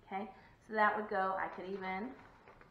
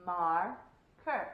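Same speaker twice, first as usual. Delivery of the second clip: MAR, KER with a long gap; slow Marker with a pause mid-word; syllable by syllable; 2 semitones lower, 4 dB louder than usual. Shown as a demonstration. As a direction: stretch Marker into two separate parts. Chin check, syllable by syllable.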